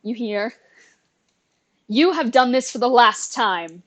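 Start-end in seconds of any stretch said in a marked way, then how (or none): 1.9-3.8 booming villain threat. Acted. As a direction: boom it out like a hidden monster. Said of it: "you hear a booming voice".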